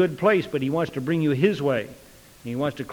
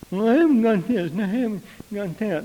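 higher-pitched mock whine; whining and griping; 5 semitones higher than usual. Believer griping before God about what is lacking, whining. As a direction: whine the list of lacks. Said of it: "whining and griping".

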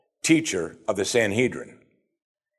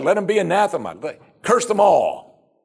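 proud condemnation; 8 semitones higher, 3 dB louder than usual. Acted. Rulers cursing the multitude, proud condemnation. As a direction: pronounce a sweeping curse on the masses. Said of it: "shows the religious pride of these men".

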